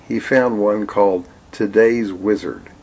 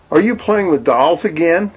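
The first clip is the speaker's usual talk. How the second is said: scolding mother; 9 semitones higher, 3 dB louder than usual. Acted.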